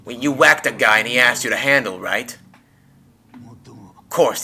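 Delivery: ordinary talk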